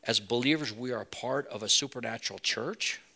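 hushed, earnest closing prayer; steady pastoral prayer voice. Acted. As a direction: pray it sincerely over the room.

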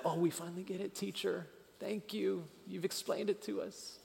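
high, soft put-on pupil voice; mock grateful disciples. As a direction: imitate meek pupils thanking their teacher. Imagined grateful disciples, sarcastic.

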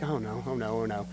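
low, soft worried aside; fretful murmur. Acted. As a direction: fret under your breath as the embarrassed mother. Embarrassed mother fretting to herself.

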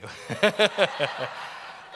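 a real burst of laughter; loud laugh. Real, not acted. Laughing at himself.

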